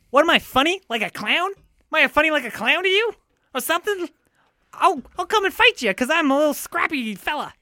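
high, needling mobster taunt; Joe Pesci impression. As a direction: goad him like a scrappy little hothead. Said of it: "we'll do Joe Pesci".

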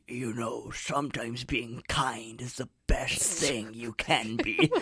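mock wise horse, low and slow; preachy horse. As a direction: hand out wisdom like a solemn old horse. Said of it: "the horse started opening his mouth".

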